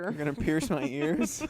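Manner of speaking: laughing, loud mock question. Real, not acted. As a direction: say it through a laugh. Laughing mishearing.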